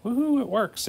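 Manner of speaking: high happy woohoo; excited cheer. Real, not acted. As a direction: cheer like you cracked it. Cheers when the sum works.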